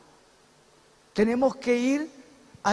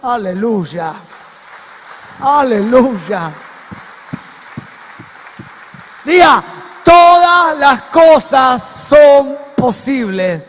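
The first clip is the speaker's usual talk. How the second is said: loud, higher-pitched preaching shout; full-force preaching; 4 semitones higher, 9 dB louder than usual. Real, not acted. Preacher proclaiming that all is possible for God, impassioned shout.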